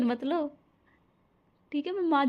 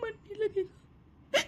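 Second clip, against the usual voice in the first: higher, softer voice breaking into tears; tearful farewell; 5 semitones higher, 4 dB quieter than usual. Acted. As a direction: bride weeping to her departing husband, sobbing at the end. Bride weeping as husband leaves.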